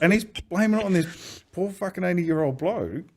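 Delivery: high, laughing outrage; indignant amusement. Real, not acted. Telling his mate the story, high amused outrage. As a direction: marvel at the injustice.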